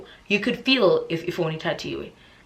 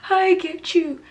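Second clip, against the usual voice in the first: tearful; 12 semitones higher, 4 dB louder, slower than usual. Retold or imitated. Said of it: "I start crying".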